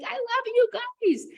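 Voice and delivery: high, slow gushing delight; squealing affection for clients; gushing love. Retold or imitated. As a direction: coo it to your clients way up high. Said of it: "I look at my Voxer and I'm like".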